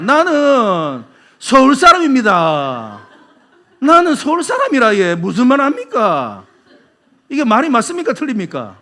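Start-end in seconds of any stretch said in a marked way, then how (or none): 0.0-3.1 loud, very slow Busan intonation; Busan accent. Shown as a demonstration. Busan native claiming to be from Seoul, Busan accent. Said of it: "부산 사람 말투로 뭐라고 말하냐". 3.8-6.4 loud, high Busan dialect protest; Busan accent. Shown as a demonstration. Busan native insisting he's a Seoulite, Busan accent. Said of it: "부산 사람 말투로 뭐라고 말하냐".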